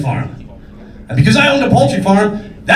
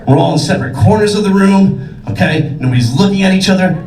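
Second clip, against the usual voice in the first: ordinary talk, nothing marked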